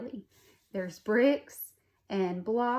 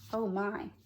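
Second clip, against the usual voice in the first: plain reading voice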